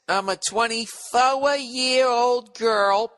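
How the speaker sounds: put-on high, slow, sing-song girl voice; girly voice reading letter. Comic reading a fan letter in a mock girl voice. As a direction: read it like a bubbly young woman. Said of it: "She says".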